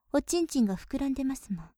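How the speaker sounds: ordinary delivery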